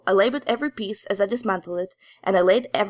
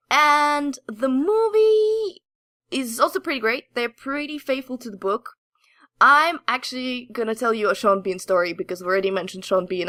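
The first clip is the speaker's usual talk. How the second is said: ordinary talk, nothing marked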